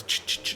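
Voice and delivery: quick voiceless clicks of a lighter; mouth-made lighter flick noise; imitated lighter flicks. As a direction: mimic a lighter being struck.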